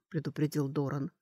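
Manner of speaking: usual speaking voice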